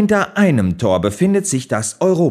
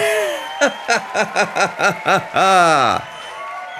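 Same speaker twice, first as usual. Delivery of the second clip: delighted laugh; 4 semitones higher than usual. Acted.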